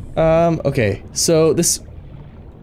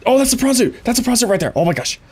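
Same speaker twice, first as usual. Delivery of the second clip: excited, higher-pitched outburst; real excitement; 4 semitones higher, much faster than usual. Real, not acted. Gamer spotting the prawn suit, excited. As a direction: burst out with the thrill of a find. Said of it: "I'm so excited".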